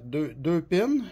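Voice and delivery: ordinary talk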